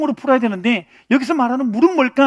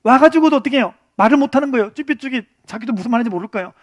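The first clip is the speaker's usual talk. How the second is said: ordinary talk, nothing marked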